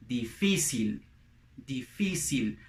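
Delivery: "difícil" with an s sound, dee-FEE-seel; Latin American Spanish. Shown as a demonstration. Teacher shows Spanish word for difficult, Latin American pronunciation. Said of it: "in Spanish, would be".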